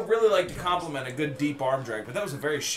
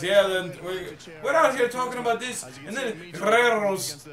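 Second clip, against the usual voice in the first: higher, hammed-up Irish commentator with rolled R's; mock Irish accent; 6 semitones higher, 3 dB louder than usual. Irked viewer imitating an Irish commentator, mock Irish accent. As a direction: put on an Irish announcer trilling his R's. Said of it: "really mad at this Irish guy".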